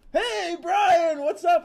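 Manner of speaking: high, loud, bubbly band greeting; cheery greeting. Retold or imitated. Band greeting the starstruck fan, loud high cheer. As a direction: greet him like old friends, big and bright. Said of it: "they're like".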